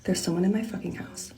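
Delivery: hushed, falling to a whisper; scared hushed voice. Real, not acted. Someone is in the house, scared whisper. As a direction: whisper it so the intruder won't hear. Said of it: "I'm so scared right now".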